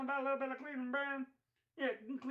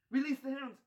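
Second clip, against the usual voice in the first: louder villain command; Mister Burns; 3 semitones higher, 5 dB louder than usual. Retold or imitated. Boss setting dogs on Kermit, Burns command. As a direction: bark the order like a tyrant boss. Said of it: "Mister Burns".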